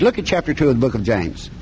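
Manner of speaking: ordinary talk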